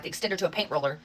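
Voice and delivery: ordinary talk, nothing marked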